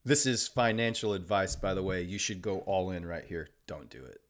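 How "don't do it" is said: quiet aside. Real, not acted. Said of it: "I'm being sarcastic".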